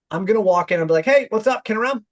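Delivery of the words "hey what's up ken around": higher and louder, breezy; confident and casual. Shown as a demonstration. Son casually asking for his dad, confident. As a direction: stroll in like you own the place. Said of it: "I'm gonna have confidence".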